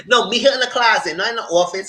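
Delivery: high, animated insistence after a laugh; amused emphatic correction. Retold or imitated. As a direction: repeat the correction loudly, amused.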